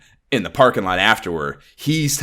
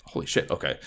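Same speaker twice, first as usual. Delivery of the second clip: laughing under breath; 8 dB quieter than usual. Retold or imitated. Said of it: "He's like".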